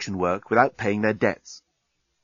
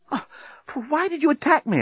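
winded. A narrator's reading. Winded journalist after the fight.

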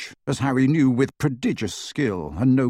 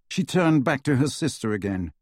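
ordinary delivery, nothing marked

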